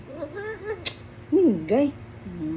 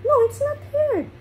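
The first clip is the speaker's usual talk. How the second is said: much higher, quick, sing-song reassurance; high pet-talk voice; 13 semitones higher, much faster than usual. Real, not acted. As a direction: coo up high to calm the pet. Soothing pet during nail cutting.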